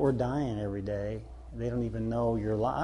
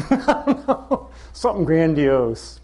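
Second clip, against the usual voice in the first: laughing; 3 semitones higher, 7 dB louder, slower than usual. Real, not acted. Laughing through his reply.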